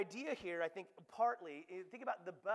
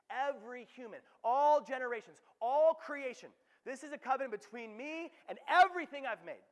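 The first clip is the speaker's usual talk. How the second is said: louder and higher, building; emphatic build; 7 semitones higher, 10 dB louder than usual. Real, not acted. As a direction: swell with each item. Building list of all creation.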